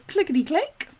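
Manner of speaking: sing-song bingo call; bingo caller. Retold or imitated. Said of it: "I've called bingo".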